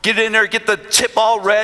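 usual speaking voice

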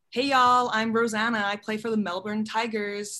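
Southern-tinged American "y'all" introduction; American accent with strong R endings, slowing at the close; American accent demo. Shown as a demonstration. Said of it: "like that".

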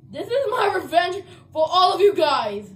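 loud, half-crying threat; angry revenge. Real, not acted. Ejected gamer threatening teammates, loud and tearful. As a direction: spit the revenge at them.

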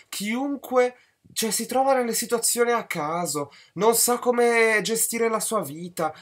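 high mocking whine of contempt; mocking rant. Real, not acted. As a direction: ridicule him in a high voice. Mocking rant about spineless character.

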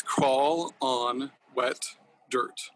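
very slow, breathy near-whisper on "Crawl on Wet"; repeating the handle for followers, very slow; slowly spelled out. Shown as a demonstration. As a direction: draw out "Crawl on Wet Dirt" word by word. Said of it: "in case people wanna really follow you".

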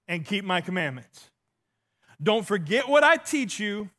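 ordinary delivery

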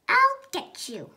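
high, loud witch threat; witchy threat. A narrator's reading. Witch sister threatening, high and loud. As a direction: screech it like a wicked witch. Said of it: "She cackled".

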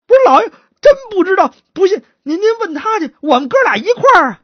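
high, hurried protest; frantic pleading. Acted. Slapped suspect pointing to his partner, frantic. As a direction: beg to be believed.

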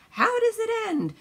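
high loud mock yell of curious passengers; retold yell. Passengers shouting at mother on a plane, loud mock yell. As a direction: call it out like an eager crowd. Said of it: "six people yelled".

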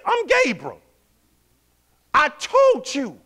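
exasperated messenger with attitude. Acted. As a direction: drag out told like a scolding. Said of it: "He had an attitude".

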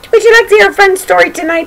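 excited invite. Real, not acted. Host greets children for storytime.

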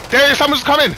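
high, loud warning yell; alarm shout. Real, not acted. Warning teammate of incoming enemy.

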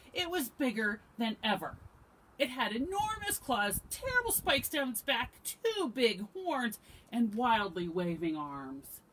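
loud, building storybook narration; dramatic story narration. A narrator's reading. Reader describes the monster to children, loud and dramatic. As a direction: pile up each scary feature loudly.

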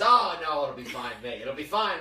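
loud, bouncy brush-off in a retold quote; breezy retold reassurance. Host imitating John shrugging off the smell, loud breezy reassurance. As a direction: imitate someone loudly waving off a worry.

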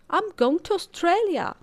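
loud, high declaration; a child defying the dentist, loud and indignant. A narrator's reading. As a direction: announce your escape in protest.

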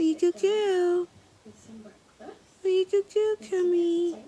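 high squeaky dog praise; squeaky praising a dog. Real, not acted.

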